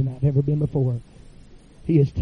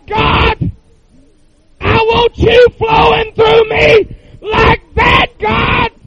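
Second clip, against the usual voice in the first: high, loud, crying shout to God; top of his voice; 10 dB louder, much slower than usual. Retold or imitated. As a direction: cry out at the top of your lungs, pleading. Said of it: "saying to the top of his voice".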